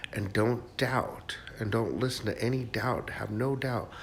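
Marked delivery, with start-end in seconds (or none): none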